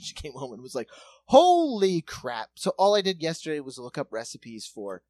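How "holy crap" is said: slow, louder drawn-out holy crap; a floored, dragged-out reaction; stunned exclamation. Retold or imitated. Ash reacts seeing the produce.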